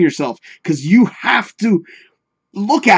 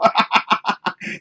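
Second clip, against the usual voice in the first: wheezy laugh; 3 semitones higher, 5 dB quieter than usual. Real, not acted. Laughs at the cult joke.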